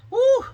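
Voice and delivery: high, loud "woo"; relieved whoop. Retold or imitated. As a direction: let out a big whoop of relief. Acting out relief after procedure.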